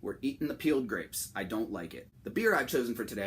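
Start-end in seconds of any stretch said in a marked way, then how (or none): none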